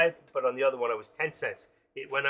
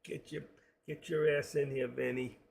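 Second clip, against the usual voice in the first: hushed mob-movie tough guy; wise guy; 4 dB quieter than usual. Acted. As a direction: play a wise guy quietly giving orders. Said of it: "I love the way they talk".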